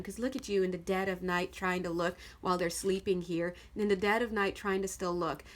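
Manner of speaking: usual speaking voice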